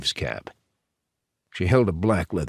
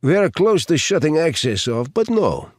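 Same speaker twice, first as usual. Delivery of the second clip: put-on high, louder Russian-accented guard; Russian accent, voice leaping up at the end; 8 semitones higher, 4 dB louder than usual. A narrator's reading. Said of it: "the guard on the left said".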